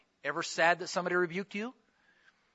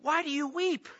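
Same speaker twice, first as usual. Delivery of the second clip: higher, puzzled husband voice; clueless husband; 7 semitones higher, 3 dB louder than usual. Retold or imitated. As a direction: play the baffled husband who doesn't get it. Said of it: "He said to her".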